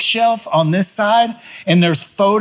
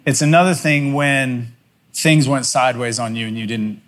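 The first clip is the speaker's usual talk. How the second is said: reflective sermon close; 6 semitones lower than usual. Acted. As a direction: let the story land gently on the congregation. Closing reflection to the congregation.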